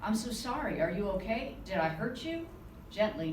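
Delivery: plain reading voice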